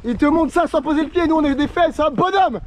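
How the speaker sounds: high, amazed praise; excited hype. Real, not acted. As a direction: hype up your buddy. Hyping up friend on the climb.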